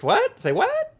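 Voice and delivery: very high, squeaky-pitched disbelief; mock surprise. Co-host hears of a breakfast beer, mock surprise. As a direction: shoot the voice way up in mock shock.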